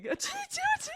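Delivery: high, breathy pretend sneezes; fake-sneeze to cover a laugh. Covering her laugh from the boyfriend with fake sneezes. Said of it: "I'm sneezing".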